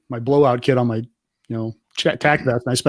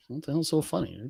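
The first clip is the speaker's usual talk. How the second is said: much softer retold aside; hushed, mock-baffled mutter; muttered puzzlement; 12 dB quieter than usual. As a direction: grumble it quietly, left out of the joke. Retells FBI guy not getting it.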